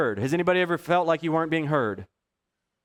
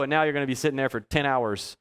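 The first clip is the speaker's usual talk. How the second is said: usual speaking voice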